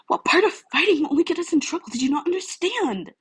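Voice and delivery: put-on high, loud exasperated boy's voice; rising frustrated rebuke; exasperated scolding. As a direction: scold her with disbelief. Izuku scolds Uraraka, high voice.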